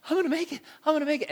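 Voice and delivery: high, eager chant of hope; excited. Retold or imitated. Surfer pumping down the wave, excited chant. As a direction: repeat it like you're urging yourself on. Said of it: "I started getting kinda all excited".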